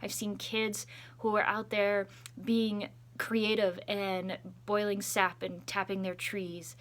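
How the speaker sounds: ordinary delivery, nothing marked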